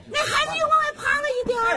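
high, drawn-level cry of alarm. Acted. Bystander watching child at the window, alarmed. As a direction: call out in alarm at a child in danger.

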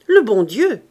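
loud, emphatic pronouncement; with authority. A narrator's reading. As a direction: state it firmly, as the last word. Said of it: "fit l'homme avec autorité". (avec autorité, fort et catégorique)

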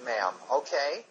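lightly voiced condescending manager, patronizing tone; mock hotel manager. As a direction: talk down to her like a smug boss. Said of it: "this is Jeff Anderson, the general manager".

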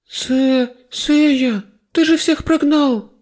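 high, slow dismayed cry; dismayed girl. A narrator's reading. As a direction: wail at him in dismay. Goddess dismayed at hero.

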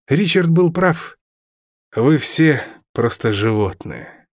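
slow scornful verdict; contemptuous. A narrator's reading. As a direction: look down on him slowly. Villain scorns Robin.

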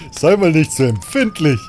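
loud, jovial teasing voice; teasing big man. Acted. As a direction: rib him good-naturedly and loudly. Gorn teasing after the slap. (lauter, gutmütiger Spott)

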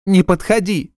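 high screaming panic; shrill shriek. A narrator's reading. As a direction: shriek it at him. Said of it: "взвизгнула она".

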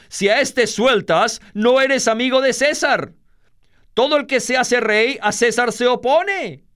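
high, insistent outcry; crowd crying out. A narrator's reading. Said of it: "los judíos daban voces". (clamor agudo e insistente)

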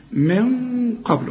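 long, dark nasal n in من before قبل; heavy ghunna. Shown as a demonstration. Teacher models hiding the noon, heavy ghunna. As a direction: hold من with a thick hum into قبل. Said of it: "الغنة مفخمة علشان القاف".

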